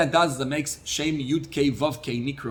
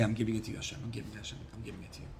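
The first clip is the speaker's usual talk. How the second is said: fading prayer; 3 semitones lower, 10 dB quieter, much faster than usual. Retold or imitated. Prayer of handing feelings over.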